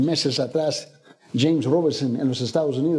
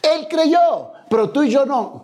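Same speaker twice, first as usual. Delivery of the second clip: much higher, louder proclamation; forceful preaching; 9 semitones higher, 5 dB louder, slower than usual. Real, not acted. Preacher contrasting Abraham with the congregation, loud and forceful. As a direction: drive the contrast home hard.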